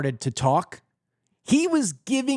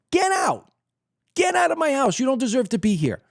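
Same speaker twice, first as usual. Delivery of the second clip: much higher, sharp furious order; ordering him out; 7 semitones higher than usual. Pretend. Homeowner throwing out the guest who repainted, high angry order. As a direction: throw the intruder out with it.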